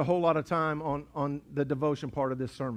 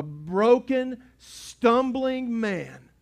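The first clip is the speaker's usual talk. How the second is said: slow, drawn out; 6 semitones higher, 4 dB louder, much slower than usual. Acted. Drawn-out self-description.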